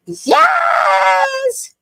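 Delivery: high, loud cry of joy; excited shout. Real, not acted. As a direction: shout it out in triumph. Delight after fixing the mic.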